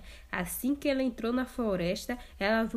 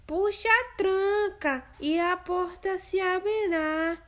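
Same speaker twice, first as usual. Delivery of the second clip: higher fake grandma voice; wolf disguising voice; 9 semitones higher, 4 dB louder than usual. Wolf posing as grandma, disguised voice. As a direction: pass yourself off as grandma. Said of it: "já com a voz disfarçada".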